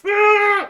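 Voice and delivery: loud high angry battle yell. Retold or imitated. Teammate charging at Robert, loud angry yell. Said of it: "He was".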